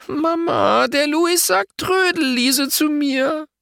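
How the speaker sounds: put-on a girl's very high, slow whiny plea; near tears. A narrator's reading. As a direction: whine to mum, close to crying. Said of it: "Lotta weint fast". (den Tränen nahe, weinerlich)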